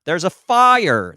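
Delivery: slow, overdone American-accented alarm; exaggerated American accent. Shown as a demonstration. Newcomer switched over to American, slow high American-accented alarm. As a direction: FIRE drawn out high, American R. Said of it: "switching over and be like".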